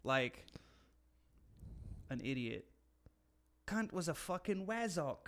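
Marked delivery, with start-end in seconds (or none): 3.7-5.3 higher-pitched mock British accent; British guy. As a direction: imitate a British guy cursing. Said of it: "I could see a British guy".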